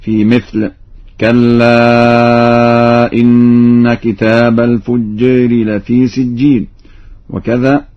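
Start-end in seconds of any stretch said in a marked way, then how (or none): 1.2-6.7 الفجار with its long a tilted toward e, al-fujjer; slow chanted verse, long-held كلا and e-coloured الفجار; taqlil. Shown as a demonstration. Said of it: "بتقليل الألف ذات الياء وذات". (تلاوة بتقليل الألف في الفجار)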